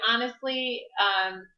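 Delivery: ordinary talk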